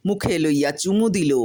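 ordinary delivery, nothing marked

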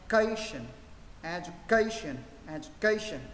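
"education" run together without pauses, stressed on CA. Shown as a demonstration. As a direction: say "education" smoothly as one quick word. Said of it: "Sau đó đọc nhanh lên".

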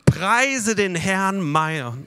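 preacher leads the church through Psalm 103, reciting slowly; slow recitation. Shown as a demonstration. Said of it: "Alle zusammen".

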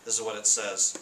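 ordinary talk

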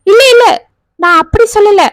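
quickly protest, loud and high; urgent denial. Acted. Hurried denial to suitor. (பதற்றத்துடன் உரத்த மறுப்பு)

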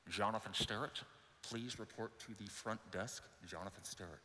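announcement over a ship speaker, low and distant; intercom page. Retold or imitated. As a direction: call out like a PA system.

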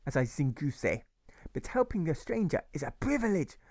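put-on a kindly old German modestly waving it off; German accent. Grocer tells Alfred helping strangers is an honor, German accent.

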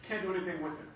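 usual speaking voice

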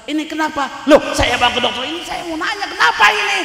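loud, high-pitched indignant protest; acted exasperation. Parent recounting the useless doctor visit, exasperated. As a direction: raise pitch and volume in mock outrage.

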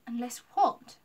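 hopeful lift on the question. A narrator's reading. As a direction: ask it with sudden hope. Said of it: "Lucy asked hopefully".

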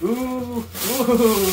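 a high, drawn-out ooh of delighted surprise; surprised ooh. Real, not acted. Collector feeling the squishy helmet lining, surprised ooh. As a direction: let the ooh rise as you touch something odd.

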